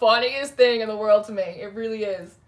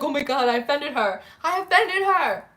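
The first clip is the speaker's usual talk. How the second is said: mock panic; 5 semitones higher than usual. Streamer retelling her worry about a viewer. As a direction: ham up the horror of offending. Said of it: "I was like".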